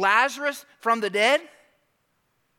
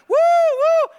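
long, high, loud whoops; fired-up crowd cheer; 11 dB louder than usual. Retold or imitated. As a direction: whoop like a fan caught up in it. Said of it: "some of the people are like".

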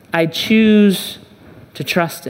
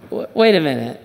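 ordinary talk

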